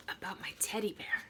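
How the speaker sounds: hushed narration; whisper. A narrator's reading. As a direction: whisper the worry.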